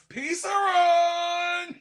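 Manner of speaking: slow stretched yell, cracking; drawn-out shout. Retold or imitated. Streamer mimics a hyped call, drawn-out yell. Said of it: "when Olivia Miles doing".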